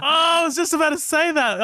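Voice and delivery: high, laughing excitement; excited laughing. Real, not acted. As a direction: squeal it out with a laugh.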